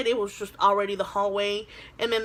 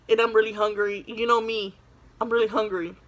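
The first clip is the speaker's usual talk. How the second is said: normal talking voice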